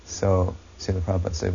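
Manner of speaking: ordinary talk, nothing marked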